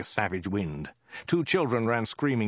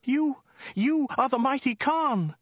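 very high, slow falsetto cry; put-on frightened woman, stammering in awe; hammed-up high-pitched awe; terrified woman crying out; 4 dB louder than usual. A narrator's reading. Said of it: "cried the woman".

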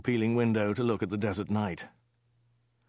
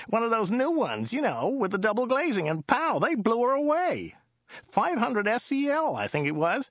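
high-pitched driver telling his tale; high, matter-of-fact storytelling driver; Joe the driver, animated; 11 semitones higher than usual. Acted. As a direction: recount it keenly in a high character voice.

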